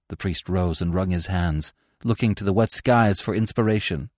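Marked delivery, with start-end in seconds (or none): none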